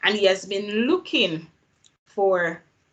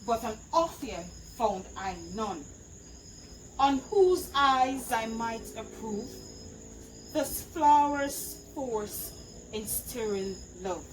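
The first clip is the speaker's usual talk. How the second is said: Puck; 7 dB quieter than usual. Acted. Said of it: "an enactment of Puck's soliloquy".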